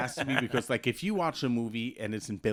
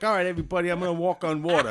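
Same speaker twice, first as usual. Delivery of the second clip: put-on British accent; 5 semitones higher, 6 dB louder, faster than usual. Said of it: "Jesus had a British accent".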